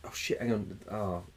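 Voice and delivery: ordinary delivery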